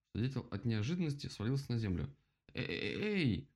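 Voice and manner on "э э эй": high, stuttered cry of protest; indignant. A narrator's reading. Woodpecker knocked to the ground, indignant. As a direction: protest with a raised, broken-up call. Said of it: "возмутился он".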